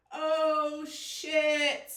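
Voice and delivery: loud cries to hold it together; yelling through tears. Real, not acted. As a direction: shout it out to keep from crying. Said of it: "That's why I'm yelling right now".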